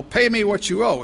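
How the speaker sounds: threatening demand. Acted. Servant shaking his fellow servant for the debt, menacing. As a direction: grab him by the throat with your voice. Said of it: "very threatening".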